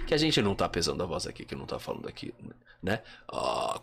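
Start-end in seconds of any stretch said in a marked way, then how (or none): 0.4-3.0 creaky low fry; vocal fry endings. Shown as a demonstration. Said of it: "que chama vocal fry". 3.3-3.8 low creaky burp-like ah; vocal fry groan. Shown as a demonstration. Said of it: "quase um arroto, que chama vocal fry".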